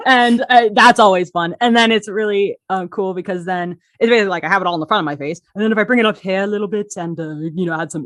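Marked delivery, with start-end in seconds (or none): none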